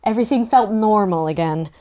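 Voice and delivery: plain reading voice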